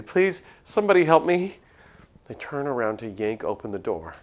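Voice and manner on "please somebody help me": desperate shriek, much higher and louder; terrified scream for help. Acted. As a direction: scream it in terror. Panicked cry for help.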